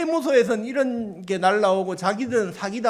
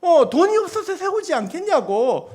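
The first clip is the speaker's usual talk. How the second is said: louder, higher mock scolding from God; preacher voicing God's rebuke, raised and pointed; God rebuking; 6 semitones higher, 5 dB louder than usual. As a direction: scold like God correcting a doubting servant. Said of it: "주님이 그렇게 꾸짖으시는 거예요".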